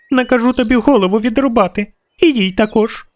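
put-on high, fast threat from a child; acted imperious girlish squeal; squealing princess. A narrator's reading. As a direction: rattle it off as an outraged little princess. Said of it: "досить цього вереску".

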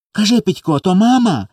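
put-on high-pitched childish pleading; pleading, near tears. A narrator's reading. Small boy questions his brother, pleading near tears. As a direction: press for an answer, about to cry. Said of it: "допитувався й Коля й лагодився вже заплакати".

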